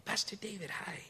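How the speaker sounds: a hint of a shy-sized little kid's voice; little boy. Little boy answering the pastor's greeting, lightly imitated child voice. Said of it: "he's this little tiny boy".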